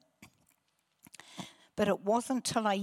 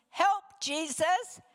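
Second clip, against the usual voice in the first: higher, drawn-out cry for help; calling out; 9 semitones higher, 3 dB louder, slower than usual. Retold or imitated. Woman calls out to Jesus for the first time, imitated calling out. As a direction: imitate her plea to Jesus.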